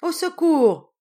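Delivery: high, loud cry for help; little pig running from the wolf and crying for help. Acted. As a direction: yell for help as you flee the wolf. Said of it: "cria le premier petit cochon".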